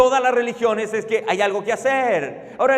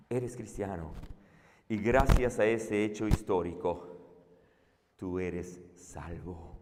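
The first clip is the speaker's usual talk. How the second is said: hushed assurance; 13 dB quieter than usual. Real, not acted. Preacher quietly assuring listeners they are saved. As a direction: quietly reassure each listener.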